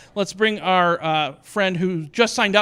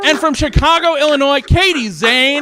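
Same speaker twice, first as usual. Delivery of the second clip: high, loud emcee introduction; announcer shout; 9 semitones higher, 9 dB louder than usual. Real, not acted. Host introduces the comic from Chicago, announcer shout. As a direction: call the performer out to the crowd.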